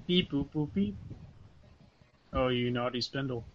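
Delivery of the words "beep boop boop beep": robot beeps; computer processing. Pretend. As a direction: beep like a little machine. Voicing the CNC machine.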